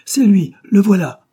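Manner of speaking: loud, high cry of discovery; excited shout from behind. A narrator's reading. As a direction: call out that he's been found. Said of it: "Une voix cria derrière lui".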